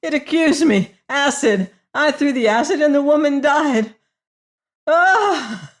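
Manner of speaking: terrified outburst. A narrator's reading. Prisoner confessing the killing, high terrified cry. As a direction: cry it out, shaking with fear.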